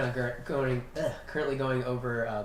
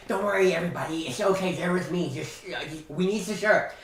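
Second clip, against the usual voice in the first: lightly voiced eager Igan, raised and urgent; Igan pleading; 5 semitones higher, 4 dB louder than usual. Game master voicing Igan begging the kitchen staff, lightly raised pleading. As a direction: hurry the cook along, a bit pushy.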